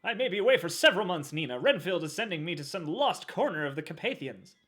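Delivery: high, loud, fast reading; hammed-up period hero; dramatic movie voice. Voicing movie line, dramatic.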